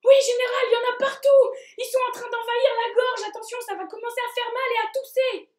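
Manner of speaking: high, loud alarmed messenger. Acted. Mouth warns virus is everywhere.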